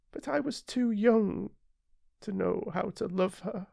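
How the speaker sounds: put-on high, regretful boy, rising to distress; little prince lamenting the flower. A narrator's reading. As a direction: regret swelling then fading.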